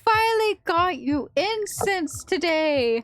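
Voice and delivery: high, slow, eager ghostly voice; Kazuki's ghost pleading proudly. Acted. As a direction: sound like an excited spirit. Ghost voice in story.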